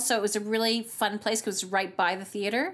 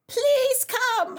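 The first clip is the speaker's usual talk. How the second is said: very high, imitated desperate plea; desperate pleading; 14 semitones higher, 4 dB louder than usual. Sick double on the phone, imitated desperate pleading. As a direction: beg like it's an emergency, hammed up. Double begging her to come.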